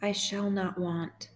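slow, measured psalm reading; guided meditation. Real, not acted. Host guiding sad listeners through Psalm twenty-three, slow meditation. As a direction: read each phrase slowly, leaving room to repeat it.

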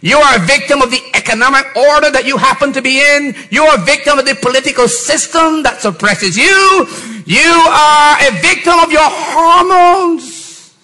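rising, forceful preacher cadence; preaching. Acted. Pastor preaching to the church, forceful. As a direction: preach it out to the congregation.

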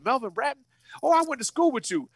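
high quick surprise; excited recognition. Retold or imitated. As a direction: sound thrilled to recognize him. Excited receptionist recognizes him.

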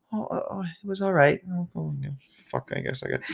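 high, soft, hesitant mock reply; small, high, uneasy voice; awkward diner. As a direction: squeak out a timid, stammered answer.

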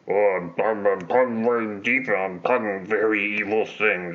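imitating a mumbling villain; mock mush-mouthed villain talk; mush-mouthed mumble. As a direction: slur the words together like Darth Mumble. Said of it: "he gets a little mush mouthy sometimes".

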